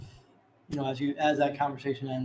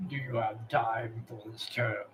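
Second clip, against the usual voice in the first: muffled orc growl; 4 dB quieter than usual. Acted. Zog asks the player about his tale in a muffled growl. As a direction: mumble it like a gruff orc. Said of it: "I didn't understand what you said there".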